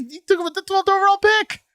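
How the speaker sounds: high, disbelieving squeal; mock shock, way up high; incredulous. Mocking disbelief at the pick.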